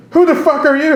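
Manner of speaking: very high-pitched retold challenge; mock drunk indignation. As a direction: imitate an affronted drunk, voice shooting way up. Drunk owner confronting the comic.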